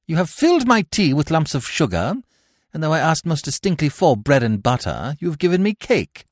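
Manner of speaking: loud, high, furious complaint slowing to a threat; indignant warning. Acted. Gwendolen rising in indignation at Cecily. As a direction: scold her then warn her.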